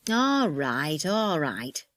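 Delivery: grudging, drawn-out agreement; sulky. Acted. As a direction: give in with a sulk. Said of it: "said Eddie in a sulky voice".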